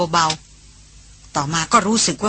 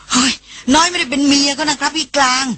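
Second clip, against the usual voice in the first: higher, startled protest; cried out in protest; 7 semitones higher than usual. Acted. Sister protests to her brother, flustered cry. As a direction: blurt it out, flustered. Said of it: "หล่อนร้องขึ้น".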